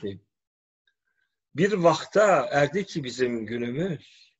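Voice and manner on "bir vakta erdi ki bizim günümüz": Sivas village dialect. Shown as a demonstration. Teacher recites Ruhsati's poem to students, in Sivas dialect. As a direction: chant the folk verse like a Sivas minstrel in local dialect. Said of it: "Biraz da halk şivesinde okuyayım dedim şiiri".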